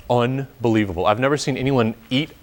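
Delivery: ordinary talk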